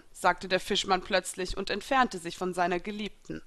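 plain reading voice

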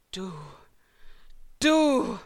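foam with fury, lost for words; seething with rage. A narrator's reading. Elsa furious at her smiling opponent, seething. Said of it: "schäumte meine Gegnerin vor Wut".

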